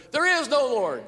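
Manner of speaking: loud high mock yell. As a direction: yell it back across the fence. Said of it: "yell at her".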